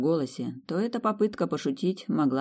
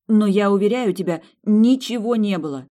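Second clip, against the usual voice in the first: pleading, raised and higher; insistent; 7 semitones higher, 6 dB louder than usual. Acted. Woman assuring her fiancé nothing happened, anxious and insistent. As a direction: press it hard, anxious to convince.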